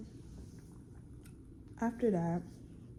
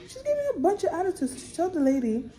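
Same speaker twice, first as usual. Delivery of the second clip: high, fast, indignant; indignant outburst; 11 semitones higher, 3 dB louder than usual. Real, not acted. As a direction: blurt it out, outraged. Indignant retelling.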